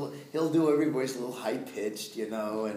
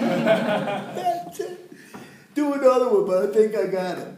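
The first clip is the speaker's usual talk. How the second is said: high, loud, thrilled; excited director; 11 semitones higher, 11 dB louder than usual. Retold or imitated. Director cheering the actor's take, loud and high. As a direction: shout it out, delighted. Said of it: "John goes like this".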